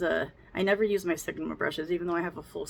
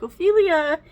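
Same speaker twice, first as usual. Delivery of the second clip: loud high call, much louder; 11 semitones higher, 11 dB louder, slower than usual. Retold or imitated. Mom calling for the baby, loud call. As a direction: yell the baby's name across the house. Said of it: "If I'm like".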